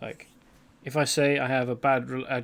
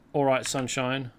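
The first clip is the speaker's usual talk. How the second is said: usual speaking voice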